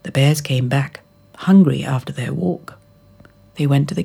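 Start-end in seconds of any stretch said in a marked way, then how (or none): none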